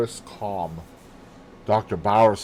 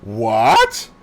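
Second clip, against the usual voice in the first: sharp, much higher, louder "What?"; shocked gasp of a question; 7 dB louder than usual. Acted. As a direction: recoil in alarm at the word. She shrinks back in shock.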